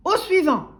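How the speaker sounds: high, loud shout; roaring butcher. A narrator's reading. Butcher dismissing timid customer, roaring. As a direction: roar for the next customer. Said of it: "rugit le boucher".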